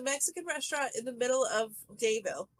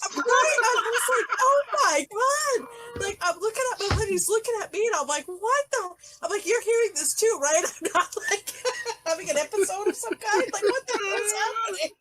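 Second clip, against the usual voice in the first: laughing disbelief; 6 semitones higher, 4 dB louder than usual. Retold or imitated. Retelling disbelief at restaurant.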